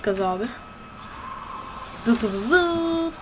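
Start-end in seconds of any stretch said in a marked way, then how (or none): 2.1-3.1 high beeps ending on a held note; slow high "dı dı" jingle; mimicked beeping. Real, not acted. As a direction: sound out a beep, stretching it high at the end. Mimics a game jingle or beep.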